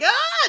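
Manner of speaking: very high cracking wail. Retold or imitated. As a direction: let out a sudden wail. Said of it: "starts to wail".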